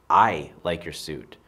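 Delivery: "I" hit loud, the rest dropping away; stress on I. Shown as a demonstration. Explaining a statement about oneself, stress on "I". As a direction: lean hard on "I", slowly.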